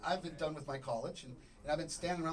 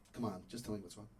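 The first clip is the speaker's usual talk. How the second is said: lower, softer plea; quiet coaxing; 7 semitones lower, 6 dB quieter than usual. Acted. Man coaxes a sulking Siri, quiet coaxing. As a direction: drop down low and coax gently.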